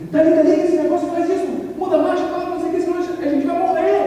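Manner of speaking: high, loud frantic orders to the driver; panicked yelling. Retold or imitated. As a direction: yell like the car will get you killed. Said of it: "eu falei". (instrutor gritando em pânico para a esposa)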